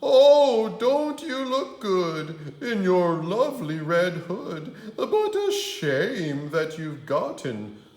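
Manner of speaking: high, loud fake granny voice; wolf squeaking a sweet granny. Wolf pretending to be Grandma, calling Red in. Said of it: "He called out".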